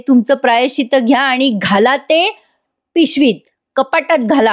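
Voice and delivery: mildly annoyed, pausing before and after "पिशवीत"; irritated, with pauses. Retold or imitated. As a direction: set "पिशवीत" apart between two short pauses. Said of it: "हे बोलताना ती pause घेते थोडा". ("पिशवीत" च्या आधी आणि नंतर थांबत, किंचित चिडून)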